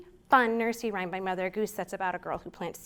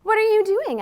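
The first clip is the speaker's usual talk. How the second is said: mother asking; 9 semitones higher, 10 dB louder than usual. Acted. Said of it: "asks my mother".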